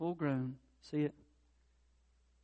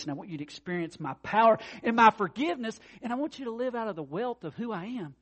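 rising preaching; 6 semitones higher, 9 dB louder than usual. Acted. Preaching God's plea.

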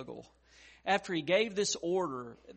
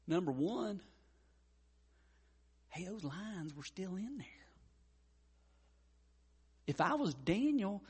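ordinary talk, nothing marked

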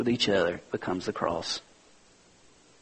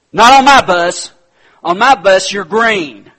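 much higher and louder, laying down the law; commanding shout; 8 semitones higher, 13 dB louder than usual. Retold or imitated. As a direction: boom it out like the boss of the bus. Driver repeats the rule.

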